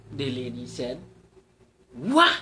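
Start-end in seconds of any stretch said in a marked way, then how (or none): 1.9-2.3 loud, high startled cry; amazed exclamation. Acted. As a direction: gasp it out at the sight of her. Cyclist struck by her beauty.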